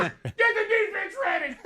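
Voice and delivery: very high-pitched frantic yell; imitated scream. Head coach giving up on the play, screaming to his defense. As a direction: screech the order in a mock panic. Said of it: "he just screams out".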